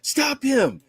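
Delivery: high, drawn-out call for help; imitated yell. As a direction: call out high and long, imitating an alarmed shout. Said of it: "I heard somebody yell".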